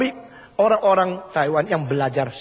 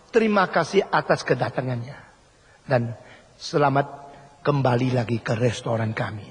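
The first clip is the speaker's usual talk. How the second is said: ordinary talk, nothing marked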